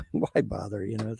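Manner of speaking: ordinary talk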